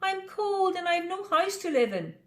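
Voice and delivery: high, tiny mouse voice; sad little mouse. Acted. Tiny mouse explaining to the pig, high and small. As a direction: sound small and cold.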